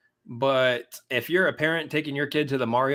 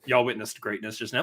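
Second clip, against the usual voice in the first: ordinary talk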